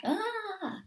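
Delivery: high, drawn-out mock scream; acted wail of frustration; triggered cry. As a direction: let out an overwhelmed "aah". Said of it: "to the point where you feel like".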